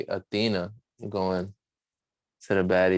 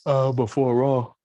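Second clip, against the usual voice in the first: words stretched by a yawn; sleepy yawn while talking; 4 semitones higher, 4 dB louder than usual. Real, not acted. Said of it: "Pardon me for the yawning".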